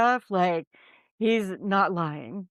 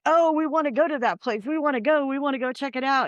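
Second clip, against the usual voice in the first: mock eager podcasters; 6 semitones higher, faster than usual. Mocking the other podcast hosts who begged to visit, higher and giddy. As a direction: mimic them begging to go, getting faster at the end.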